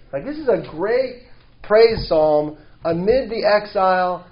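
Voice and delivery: ordinary talk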